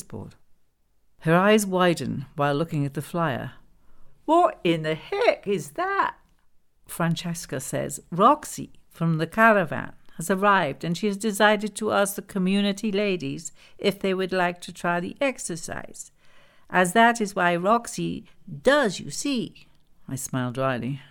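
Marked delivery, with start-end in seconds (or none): 4.3-6.2 high, slow, loud outraged question; appalled disbelief. Acted. Glenda shocked by the flyer. 8.1-19.7 voiced Italian woman explaining in accented English; thick Italian accent. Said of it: "in a thick Italian accent".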